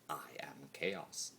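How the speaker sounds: breathy whispered confession, slowed right down. Acted. Chaos spirit confessing his nature to himself, whispered. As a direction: let it slip out as a whisper. Monologue, sudden hushed admission.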